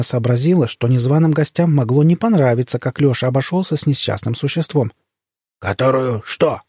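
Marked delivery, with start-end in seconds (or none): 5.6-6.6 slow, wary creature question; suspicious crocodile. A narrator's reading. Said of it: "подозрительно переспросил крокодил".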